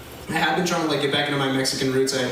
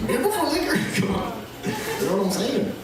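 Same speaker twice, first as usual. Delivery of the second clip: high put-on Mexican dad voice; East LA dad accent; 7 semitones higher than usual. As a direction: slip back into the dad's East LA accent. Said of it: "working on the accent".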